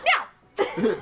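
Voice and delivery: high, eager mock meow; imitated cat meow. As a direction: meow like a cat finding its owner. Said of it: "he'd run in there and see me".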